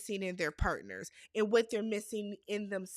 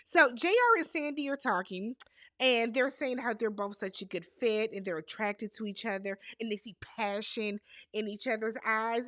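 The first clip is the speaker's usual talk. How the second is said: usual speaking voice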